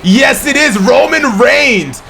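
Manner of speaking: high, slow, shouted victory call; triumphant shout. Real, not acted. Commentator declaring Reigns' first pay-per-view win, shouting. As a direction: drag out the win announcement.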